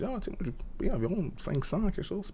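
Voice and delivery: high, light mock-casual reply. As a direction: say the price high and breezy. Telling the kids the price.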